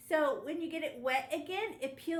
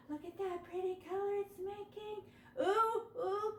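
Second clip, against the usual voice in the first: higher, hushed excited marvel; mock wonder; 5 semitones higher than usual. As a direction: sell the magic of the color to the kids. Teacher marvels at blending colors.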